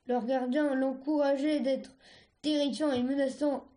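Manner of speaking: usual speaking voice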